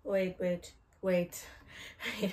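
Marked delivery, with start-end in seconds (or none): none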